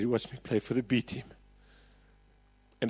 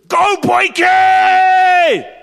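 huge, long, much higher shout across the field; sideline shout; 17 dB louder, much slower than usual. Retold or imitated. Dad cheering his son from across the field, a huge drawn-out shout. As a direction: bellow it from the far touchline.

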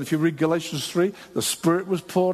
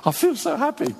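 high-pitched, happy imitation; acted joy, pitched up; delighted new convert; 9 semitones higher than usual. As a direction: bubble over like someone just converted.

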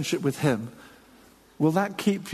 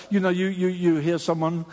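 ordinary talk

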